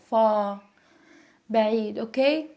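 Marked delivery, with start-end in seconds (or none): 0.1-0.6 far ending in an open vowel, no R; British-style far, R silent; British dropped R. Shown as a demonstration. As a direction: drop the R from far. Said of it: "They turn it like".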